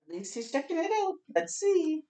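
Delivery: quick hopeful high voice; hopeful aside. Acted. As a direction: rattle it off, hopeful and eager.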